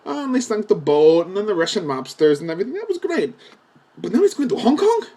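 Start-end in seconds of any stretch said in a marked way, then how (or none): 4.0-5.1 much higher, disbelieving question; mock-outraged disbelief; incredulous. Real, not acted. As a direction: throw up your hands in disbelief. Incredulous about the plot.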